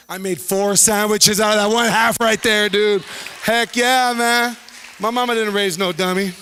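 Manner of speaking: loud, proud boast; triumphant. Real, not acted. Comedian bragging about his sandwich trick, loud. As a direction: crow about your clever trick.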